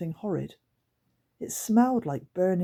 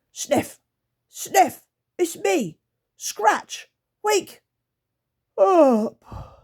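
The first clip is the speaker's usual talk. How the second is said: much higher, louder calls to wake a friend; creature calling; 14 semitones higher, 7 dB louder, much slower than usual. Acted. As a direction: shout the friend awake, creature-like. Scratch waking fainted Sniff.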